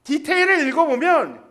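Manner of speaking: ordinary talk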